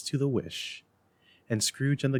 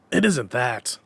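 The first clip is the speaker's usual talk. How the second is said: heated; 4 dB louder than usual. A narrator's reading. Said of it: "heated by the remark".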